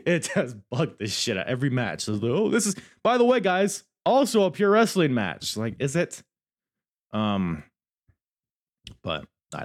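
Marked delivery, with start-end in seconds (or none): 2.3-5.5 higher, louder, hurried mock announcer. Reviewer mimics announcer calling every match pure wrestling, mock announcer. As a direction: imitate an over-eager announcer, pitched up and loud. Acting out the announcer's repeated line.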